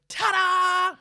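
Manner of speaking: high, booming showman's flourish; dramatic reveal. Retold or imitated. Cafe owner revealing himself to the customer, hammed-up and loud. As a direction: belt it out like a grand unveiling. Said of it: "does this big dramatic turnaround".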